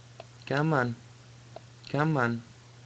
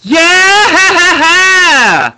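long loud victory yell; cheering; 20 dB louder than usual. Real, not acted. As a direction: cheer then burst out laughing. Win, long cheer into laugh.

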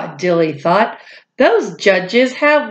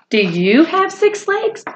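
excited question; 9 semitones higher than usual. Acted. Asking children a question.

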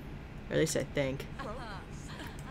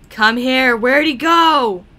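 louder, higher calling out; 6 semitones higher, 9 dB louder, slower than usual. Real, not acted. Player calling to a sim who wandered off, loud. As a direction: shout after him as he leaves.

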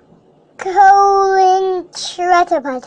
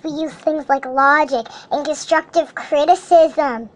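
normal talking voice

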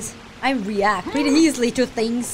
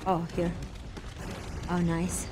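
ordinary delivery

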